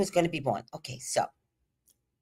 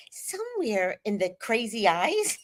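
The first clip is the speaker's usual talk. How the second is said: normal talking voice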